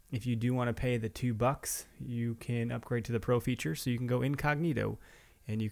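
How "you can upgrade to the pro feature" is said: ordinary talk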